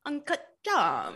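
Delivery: high, slow mimicked "Uncut Gems"; catchphrase impression. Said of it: "walking around my apartment this morning going".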